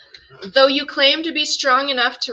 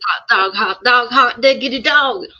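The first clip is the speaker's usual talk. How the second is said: louder, lightly voiced cartoon cheer; cartoon catchphrase; 9 dB louder than usual. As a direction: call it out like a cartoon sidekick. Mickey Mouse catchphrase.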